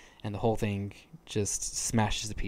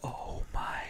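soft, whispered awe; hushed shock; 5 dB quieter than usual. Retold or imitated. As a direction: let it out as a stunned, breathy whisper. Retells own shocked reaction to scene.

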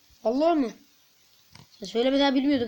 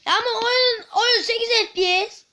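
whiny complaint; 10 semitones higher, 5 dB louder than usual. Real, not acted. Game lags, whines.

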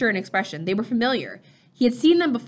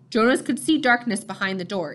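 plain reading voice

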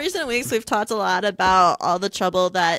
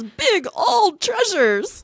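awed excitement; 11 semitones higher, much slower than usual. Acted. Marvelling at buried treasures.

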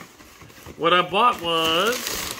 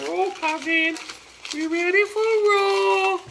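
rally your cousin in a high hype voice; hyped-up cry. Acted.

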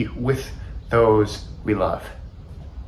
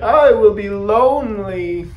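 high, loud lonely wail; pleading shout; 10 semitones higher, 6 dB louder than usual. A narrator's reading. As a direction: wail it out after your lost friend. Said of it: "he shouted".

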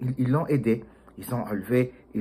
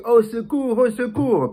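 high, louder cries of au secours; a trapped mouse calling out; crying for help; 11 semitones higher, 4 dB louder, slower than usual. Acted. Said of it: "Elle était en train de crier".

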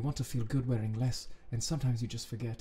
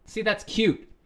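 giddy reaction; 5 semitones higher, 8 dB louder, slower than usual. Real, not acted.

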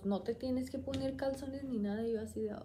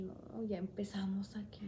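softer, hushed dread; nervous whisper; 2 semitones lower, 7 dB quieter than usual. Retold or imitated. As the nurses arrive, a nervous whisper. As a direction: murmur it under your breath.

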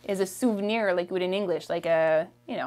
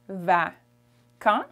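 normal talking voice

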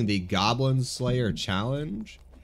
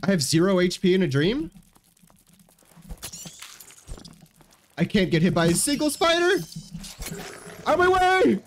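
panicked yelling; 8 semitones higher than usual. Real, not acted. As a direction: yell in real panic. Panicking at zero HP.

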